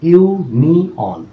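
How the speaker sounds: ইউ নি অন in three separate beats; syllable by syllable. Shown as a demonstration. Teacher showing what a syllable is, syllable-by-syllable. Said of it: "সেটা একটা syllable".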